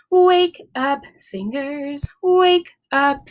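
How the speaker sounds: usual speaking voice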